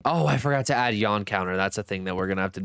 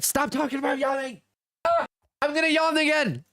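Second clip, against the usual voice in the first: mock whine. Real, not acted. Scolding chat about yawning.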